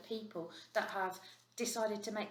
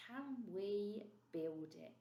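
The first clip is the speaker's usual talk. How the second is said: slow question; 3 semitones higher, 3 dB quieter, much slower than usual. Shown as a demonstration.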